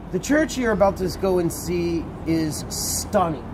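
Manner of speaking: ordinary talk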